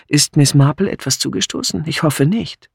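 ordinary delivery, nothing marked

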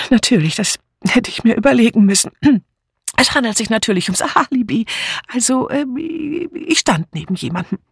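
high, fast, dithering spinster voice; flustered Miss Murgatroyd. A narrator's reading. Miss Murgatroyd fumbling her alibi for the inspector, flustered.

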